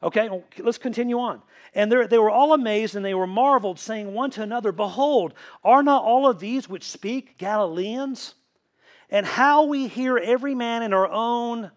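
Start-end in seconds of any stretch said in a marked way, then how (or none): 1.7-11.7 forceful scripture reading. Real, not acted.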